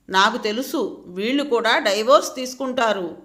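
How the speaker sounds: ordinary delivery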